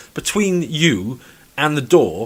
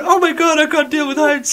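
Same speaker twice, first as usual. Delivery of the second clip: much higher, squealing in mock fright; mock terror; 14 semitones higher than usual. Mocking a brain scared of heights, high mock fright. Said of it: "It's not my brain going".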